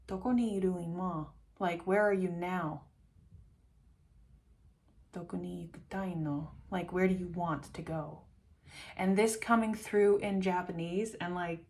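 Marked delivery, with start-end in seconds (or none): none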